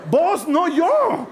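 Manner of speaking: loud, high and very slow teasing; telling Jesus it's his problem, teasing; teasing punchline. Real, not acted. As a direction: poke fun, stretching every word.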